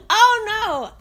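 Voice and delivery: high oh no broken by laughter; laughing alarmed cry. Retold or imitated. Retelling Bernice's yelp to the listener, high and laughing. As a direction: squeal it while cracking up. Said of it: "She said".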